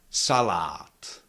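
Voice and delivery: "Salad" with two full ah vowels, second long. Shown as a demonstration. Teacher showing unchanged vowel quality, full-vowel "Salad". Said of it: "All the vowels ... are pronounced fully".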